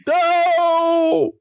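high, drawn-out mock wail of no; mock anguished cry. Host reacting to the story being over, mock anguished cry.

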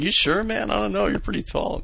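high, hesitant questioning; gently doubt his plan in a high voice; mock-worried, high-pitched friend; imitated doubtful concern.

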